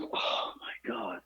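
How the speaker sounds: breathless unvoiced whisper of disbelief. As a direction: mutter it under your breath in disbelief. Exasperated aside at clueless worker.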